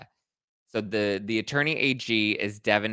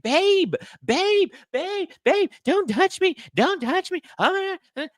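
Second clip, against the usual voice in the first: very high, far squealing protest, slowing at don't touch; hysterical mock wail; 6 dB louder, slower than usual. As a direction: shriek at the cops like a hysterical drunk. Hysterical woman resisting police.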